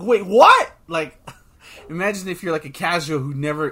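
ordinary delivery

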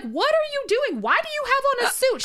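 high-pitched baffled questioning, imitated; high, insistent retold questions; Bunny. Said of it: "and she's like".

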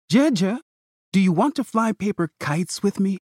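put-on high, eager child voice; hopeful little girl asking; little sister voice. A narrator's reading.

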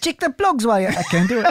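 high put-on South African accent; a little South African, meant as Australian. Host trying an Australian accent that lands South African. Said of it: "That was actually South African".

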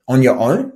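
"On your own?" with the pitch going up at the end; upward inflection. Shown as a demonstration. Coach showing salespeople the rising tune on the key words. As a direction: let "own" rise into a question. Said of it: "Like, I go up".

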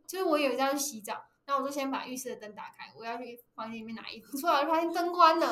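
normal talking voice

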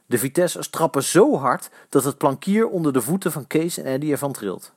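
usual speaking voice

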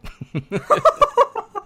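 a high, loud burst of laughter; squealing real laugh; shrieking laugh. Real, not acted. Reacts to swallowed jerky.

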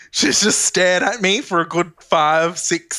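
ordinary delivery

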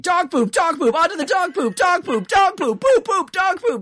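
high, loud chant of dog poop; mock screaming commentary. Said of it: "commentary literally is just screaming".

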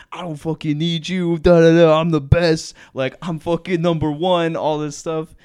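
loud, high mock rant, fast and heated; freaking out. Boyfriend freaking out at his girlfriend, loud mock rant. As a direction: blast it like a boyfriend losing it. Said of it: "freaking out".

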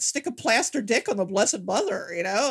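ordinary talk

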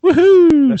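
high, loud woohoo; cheering. Acted. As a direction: cheer the winner loudly. Celebrating giveaway winner.

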